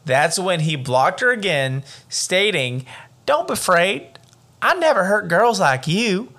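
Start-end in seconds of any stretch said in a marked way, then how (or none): 3.2-6.3 high, badly faked Southern accent; hammed-up Southern accent; attempted Southern accent. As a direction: play the stranger with a clumsy Southern lilt. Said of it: "did I do it again?".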